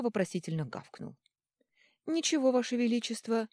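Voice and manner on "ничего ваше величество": higher, innocent, polite; suddenly all innocence; changed tone. Acted. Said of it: "сменил тон целитель".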